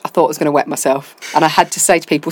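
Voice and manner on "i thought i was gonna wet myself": usual speaking voice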